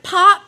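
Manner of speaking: loud mouth pop, pitch up; bottle pop sound. Retold or imitated. Opening the bottle in the car, loud mouth pop. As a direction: pop it like a cap. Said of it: "I'm like".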